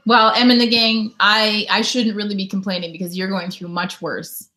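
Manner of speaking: usual speaking voice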